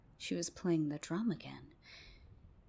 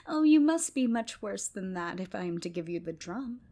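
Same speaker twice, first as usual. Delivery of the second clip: put-on louder, teasing girl's voice; taunting girl; 7 dB louder than usual. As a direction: tease them, louder.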